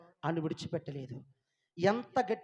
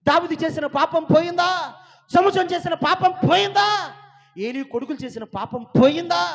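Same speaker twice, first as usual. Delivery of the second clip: loud, high challenging questions; shouted rhetorical questions from the pulpit; full-force preaching; 10 semitones higher, 11 dB louder than usual. Acted. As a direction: fire each question at the congregation, loud and high.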